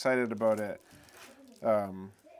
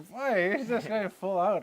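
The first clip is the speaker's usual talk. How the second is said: higher and louder, swept up; excited telling; 9 semitones higher, 4 dB louder, slower than usual. Real, not acted. Telling a friend about the loud prayer, excited. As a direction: pitch up in amazement.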